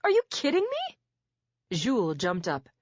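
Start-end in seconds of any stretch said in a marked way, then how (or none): 0.0-1.0 very high, loud incredulous outburst; can't believe what she hears; outraged disbelief. A narrator's reading. Defendant outraged at judge.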